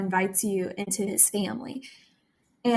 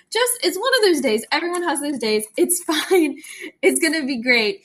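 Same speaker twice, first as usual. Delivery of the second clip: higher and louder, pushing through with a laugh; high, loud self-reassuring cheer breaking into a laugh; forced cheer; 6 semitones higher, 6 dB louder than usual. Real, not acted. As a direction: talk yourself into it brightly with a laugh. Forces cheer after a hard day.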